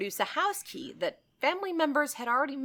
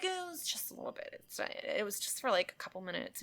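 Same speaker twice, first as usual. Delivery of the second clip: nurse playing it down; 9 dB quieter than usual. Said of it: "And she's like".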